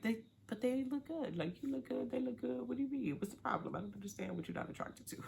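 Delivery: high, soft, puzzled mock protest; baffled protest. Viewer questioning why they aren't attracted, high baffled protest.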